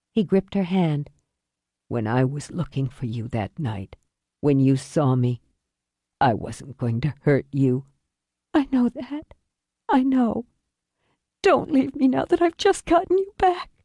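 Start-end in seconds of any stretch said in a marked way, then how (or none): 1.9-7.9 low, weary man's voice; dying father. Acted. Dying father reassures daughter. 8.5-13.7 put-on high, pleading young woman's voice; grieving daughter. Daughter pleads with dying father.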